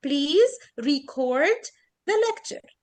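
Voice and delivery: record as ri-CORD, drawn out on "cord"; very slow, second syllable of record lengthened; verb stress. Shown as a demonstration. Teacher repeating the verb example, record stressed and stretched at the end. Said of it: "ستريس يعني بطول السيلابل نفسها".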